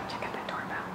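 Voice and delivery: quick whisper aside. Real, not acted. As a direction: whisper it so nobody hears. Pointing out the doorbell.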